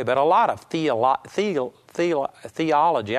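tripping on "theo-, theo-" before landing on "theology"; stumbling over a word. Real, not acted. Preacher fumbling "theology" mid-sermon, stumbling restarts. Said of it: "I can't even pronounce it".

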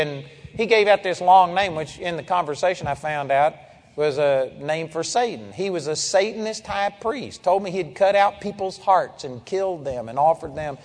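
ordinary talk

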